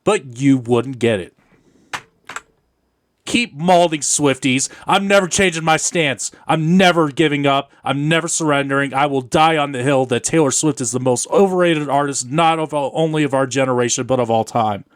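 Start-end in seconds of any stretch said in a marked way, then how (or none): 3.2-14.8 louder, defiant and taunting; defiant rant. Real, not acted. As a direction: taunt the fans, never backing down.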